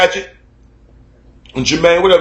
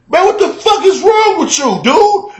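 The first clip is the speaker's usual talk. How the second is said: retold yell; 14 semitones higher, 3 dB louder than usual. Retold yelling at staring neighbor.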